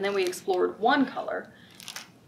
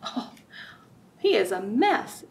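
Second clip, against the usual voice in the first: plain reading voice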